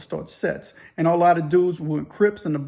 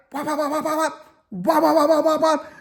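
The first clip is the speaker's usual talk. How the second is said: rapid high-pitched "wop wop" chopping sounds; imitated chopping noise; 8 semitones higher than usual. Inmates chopping up food for a hookup, imitated chopping noise. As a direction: mimic a knife chopping in high, repeated bursts.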